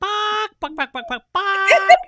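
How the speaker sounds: high, loud clucking squawk; chicken squawk. Acted. Telling the host what that sounds like, squawking chicken imitation. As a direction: cluck the words like a hen, last one highest. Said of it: "We need to learn from the chickens".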